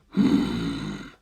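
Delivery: calm inhuman curiosity; low, slow, quiet voice of a detached monster; cold ancient being. A narrator's reading. Cars voice.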